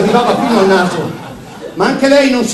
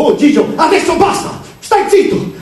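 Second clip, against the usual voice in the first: angry outburst; 9 semitones higher, 4 dB louder than usual. A narrator's reading. Alex silencing Gigio in quarrel.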